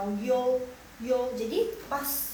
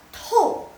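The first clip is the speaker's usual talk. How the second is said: "to" as tou, high, loud, sharply falling; fourth tone; 11 semitones higher, 10 dB louder than usual. Shown as a demonstration. Teacher contrasts four tones, falling fourth. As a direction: throw "to" down from the top. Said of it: "Nada keempat".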